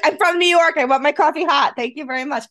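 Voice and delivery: mock-indignant insisting on hot coffee; playfully huffy customer. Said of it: "I'm like".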